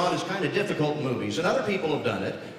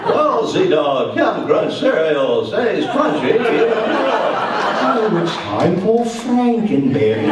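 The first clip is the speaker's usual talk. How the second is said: cereal ad voice; 4 semitones higher, 7 dB louder, much slower than usual. Retold or imitated. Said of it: "sounded like".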